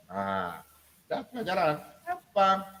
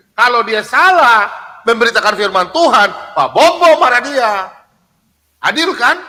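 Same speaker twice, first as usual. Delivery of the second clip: forceful; 4 semitones higher, 7 dB louder than usual. Real, not acted. Preacher arguing about just anger.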